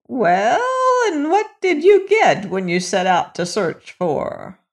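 put-on high, creaky old crone voice. A narrator's reading. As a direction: voice her like an old witch. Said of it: "she asked".

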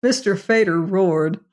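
ordinary delivery, nothing marked

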